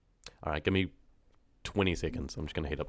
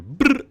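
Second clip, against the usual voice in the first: loud rolled brr; 14 dB louder than usual. Shown as a demonstration. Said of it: "not rolling your tongue like rrr".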